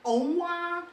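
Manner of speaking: "ong wah" high, loud and drawn out; mock Cantonese sound ong wah. Shown as a demonstration. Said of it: "this very distinct".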